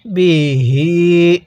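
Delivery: বিহি very slow with the long হি held; slow tajweed elongation of বিহি; drawn-out recitation. Shown as a demonstration. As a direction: stretch বিহি out as in Quran reading. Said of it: "আরেকবার পড়ুন।".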